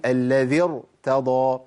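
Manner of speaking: "الذي ارتضى" drawn out with a thick ر; heavy ra. Shown as a demonstration. Teacher settles the doubt, showing "الذي ارتضى" with heavy ر. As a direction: recite "الذي ارتضى" slowly, ر full and heavy. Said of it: "الراء تفخم. أقول".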